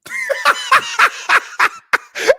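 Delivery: loud, high-pitched burst of laughter; high staccato cackle; high cackling laugh. Real, not acted. Laughing before starting the show.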